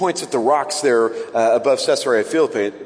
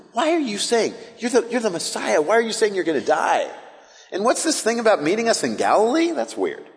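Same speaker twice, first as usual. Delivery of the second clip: acted bafflement, pitched higher; puzzled disciples; 6 semitones higher than usual. Disciples to the Messiah about his coming death, puzzled. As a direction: question it in high-pitched confusion. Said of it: "all they're seeing right here is".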